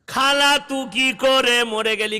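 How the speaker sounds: imitation keening in a stretched singsong; mock wailing. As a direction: wail it slowly like a hammed-up mourner. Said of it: "গিয়ে কাঁদছে".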